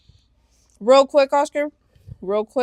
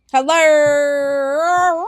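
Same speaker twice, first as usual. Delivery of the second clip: long, strange sung-out hello; drawn-out mock hello; 3 semitones higher, 5 dB louder than usual. Sister retelling her prank call greeting, drawn-out silly hello. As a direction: stretch the hello into a silly noise. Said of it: "But I went".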